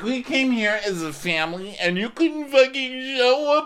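mock wailing complaint. Guest wailing at absent friend about dinner. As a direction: wail it like a hurt drama queen.

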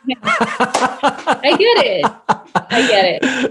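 slow, amused concession through a laugh; laughing agreement. Retold or imitated. The actress answering her fellow actors, amused and laughing. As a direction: chuckle while giving in good-naturedly. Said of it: "I'm like".

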